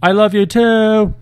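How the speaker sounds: high, loud, drawn-out call; slow shouted reply, pitched well up; yelled back. A narrator's reading. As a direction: holler it back over your shoulder. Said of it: "without looking back, yelled".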